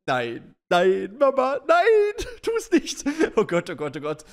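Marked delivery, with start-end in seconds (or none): 0.1-3.0 flehendes Kind. Retold or imitated. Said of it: "das Kind, was so da sitzt und sich so denkt so".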